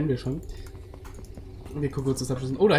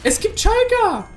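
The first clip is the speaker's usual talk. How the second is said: excited exclamation; 5 dB louder, slower than usual. Real, not acted. Surprised by a boss name.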